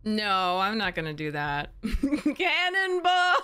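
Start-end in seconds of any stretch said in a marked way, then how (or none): 2.3-3.3 slow excited yell; cannonball shout. Pretend. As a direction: whoop it as you leap. Jumping into the lake.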